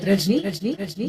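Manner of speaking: very slow, soft, drawn-out name; drawn-out gentle reproach. Acted. Husband gently reproaching his wife by name. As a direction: stretch her name out softly, disappointed.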